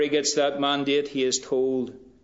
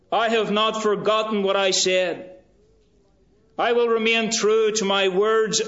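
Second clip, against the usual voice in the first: God's promise, rising to a loud high proclamation; voicing God; 7 semitones higher, 4 dB louder than usual. Preacher voicing God's vow to Moses, loud and high. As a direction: proclaim it like God himself speaking.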